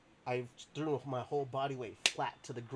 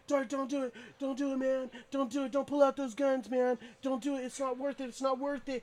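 high, frantic begging voice; high desperate pleading friend; 11 semitones higher, 4 dB louder than usual. Retold or imitated. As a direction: beg him to stop, high and panicked. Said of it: "And he's like".

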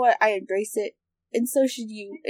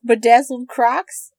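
ordinary delivery

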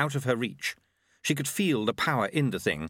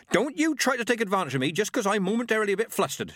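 fast, high, sharp retort; the flustered old witch snaps back; snapped; 11 semitones higher, faster than usual. A narrator's reading. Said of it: "she snapped".

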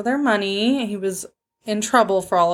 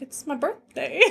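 sheepish; 6 semitones higher, 9 dB quieter than usual. Retold or imitated. Retells own timid answer to stripper.

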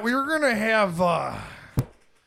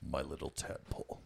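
low, soft gloating villain voice; villain monologue; 14 dB quieter than usual. Retold or imitated. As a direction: purr it like a fond, gloating villain. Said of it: "could you imagine that villain monologue".

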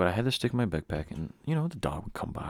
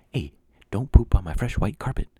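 mock scolding; 4 semitones higher, 9 dB louder than usual. Scolding a dog.

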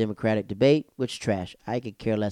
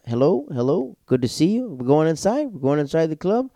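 high, raised greeting voice; imitated eager greeter; 6 semitones higher, 4 dB louder than usual. Funny comic greets friends outside the club, eagerly welcoming. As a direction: greet arrivals in a lifted voice.

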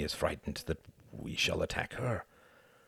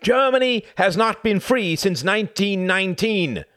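cried out; 9 dB louder than usual. Acted. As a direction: cry it out in indignation. Cuthbert still protesting.

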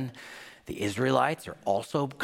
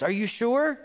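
doubting mom; 11 semitones higher than usual. Retold or imitated. Mom checking on son.

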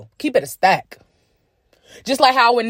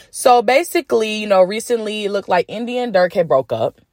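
ordinary talk

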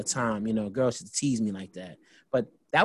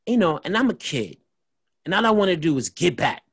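ordinary talk, nothing marked

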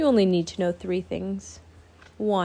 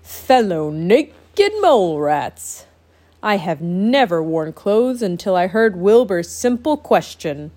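regal proclamation; 5 dB louder than usual. Acted. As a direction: proclaim it like a noble king. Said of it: "and proclaimed".

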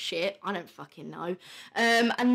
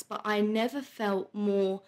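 normal talking voice

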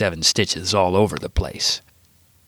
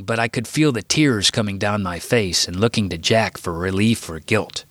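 ordinary delivery

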